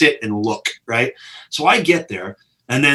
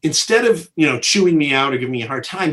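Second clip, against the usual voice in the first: ordinary delivery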